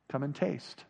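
soft, low invitation; hushed, calm answer; Jesus' gentle call. Acted. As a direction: invite them very quietly. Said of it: "Doesn't that sound like Jesus' voice".

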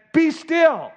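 loud, high commanding rebuke. Acted. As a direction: order the storm to stop. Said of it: "It was a rebuke before it was a comfort".

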